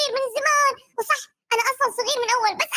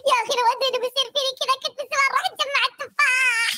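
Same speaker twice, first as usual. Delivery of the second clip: ordinary talk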